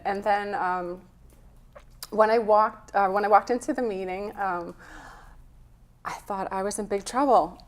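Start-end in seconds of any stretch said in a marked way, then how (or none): none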